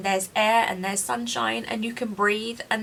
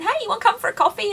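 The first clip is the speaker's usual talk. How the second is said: much higher, louder and fast, hammed-up eager invite; eager lonely caller; 11 semitones higher, 6 dB louder, faster than usual. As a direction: play the overkeen friendless caller chirping down the phone. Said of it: "little Betsy No Mates who's, like, on the phone".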